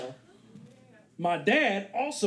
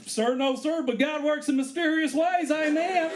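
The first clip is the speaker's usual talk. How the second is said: high, shouting praise; megachurch preacher; 7 semitones higher than usual. Retold or imitated. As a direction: preach it to the cop with fervor. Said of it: "megachurch preacher".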